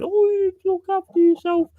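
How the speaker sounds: high, far-off sugary mock concern; high-pitched sarcastic sympathy. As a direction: send up the fake caring commenters. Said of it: "everybody gets in there going".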